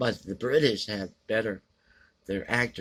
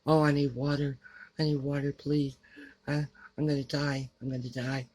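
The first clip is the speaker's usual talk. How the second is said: higher-pitched whiny imitation; mock whining; 6 semitones higher than usual. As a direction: mimic a heroine pleading and whining. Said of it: "she whines too much".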